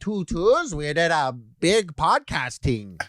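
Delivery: put-on slow, high Toronto accent sign-off. Ending the podcast in a mock Toronto accent, slow and high. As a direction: drawl the Toronto slang as a joke. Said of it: "the Toronto accent".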